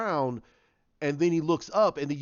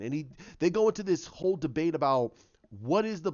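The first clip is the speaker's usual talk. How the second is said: ordinary delivery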